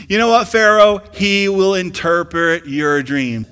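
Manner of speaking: loud, high-pitched preacher's shout; preaching at full force. Acted. Pastor preaching Joseph's story to his congregation, full force. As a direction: preach it out to the whole church.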